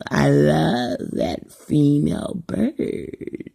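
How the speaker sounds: slow, whimpering mock swoon; mock lovesick quote. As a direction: mimic her gushing over the bird, pathetic and slow. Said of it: "the pheromones in your voice was like".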